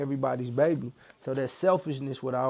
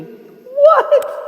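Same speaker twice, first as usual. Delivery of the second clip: sudden high squeal of pain; startled squeal; 16 dB louder than usual. Real, not acted. Guest yelps as the tattoo needle hits, a high squeal.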